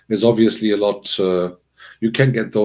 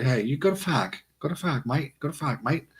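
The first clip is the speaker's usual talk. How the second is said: lightly put-on British accent, softer voice; casual British "mate", pitched up; British slang imitation; 5 semitones higher, 6 dB quieter than usual. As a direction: imitate a British local bumming a smoke. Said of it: "when I was living in the U K".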